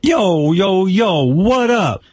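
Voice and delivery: high, drawn-out street-slang greeting; mock hip-hop wannabe. Shown as a demonstration. Host demonstrates the role for his guest, mock hip-hop voice. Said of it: "can't you be a wigger?".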